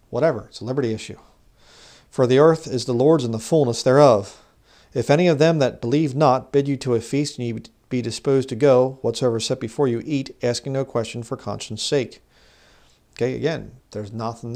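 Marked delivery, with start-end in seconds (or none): 2.1-12.2 loud, declamatory Bible reading; scripture reading. Acted. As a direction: proclaim the verse to the congregation in a raised voice. Reads scripture aloud to the congregation.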